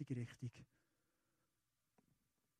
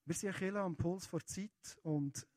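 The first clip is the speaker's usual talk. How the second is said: ordinary delivery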